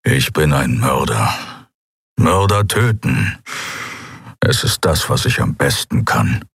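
a hint of a weary murderer; grim assassin. A narrator's reading. Keru admits he is a killer, grim low voice.

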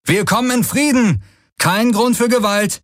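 high, urgent calling-out; lauter Zuruf. Acted. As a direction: call out high to approaching riders. Ruf an heranfliegende Reiter. (hoher, beschwörender Ruf)

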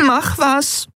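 very high, pleading squeak of a voice; a small frightened creature begs for action; urging small creature. Acted. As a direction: urge them on in a tiny high voice. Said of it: "drängte Nelen".

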